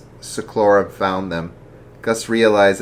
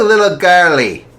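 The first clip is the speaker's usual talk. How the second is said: high character voice; 11 semitones higher, 9 dB louder than usual. Acted. Character voice in read story.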